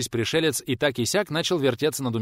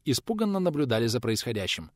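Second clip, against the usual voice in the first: ordinary delivery, nothing marked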